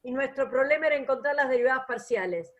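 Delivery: fast, brisk recap read-through; quick reading. Real, not acted. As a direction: read it quickly, it has been said already. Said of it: "lo leo rápido porque es lo que dije".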